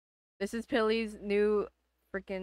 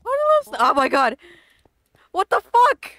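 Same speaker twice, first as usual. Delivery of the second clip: shocked shriek; 10 semitones higher, 13 dB louder than usual. Real, not acted. Shocked by strange mob.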